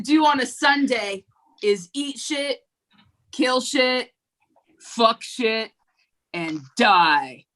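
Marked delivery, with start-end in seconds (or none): none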